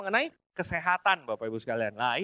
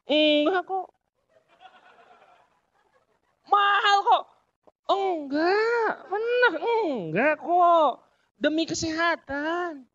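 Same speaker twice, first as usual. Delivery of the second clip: higher, louder mock plea, slow, almost crying; pleading seller; 7 semitones higher, 4 dB louder, much slower than usual. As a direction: beg the buyer, half-whining. Acted seller pleading with buyer. (penjual memohon, setengah merengek)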